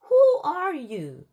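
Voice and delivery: high, loud, slow shout. Retold or imitated. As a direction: yell it at the caller. Shouts at the caller.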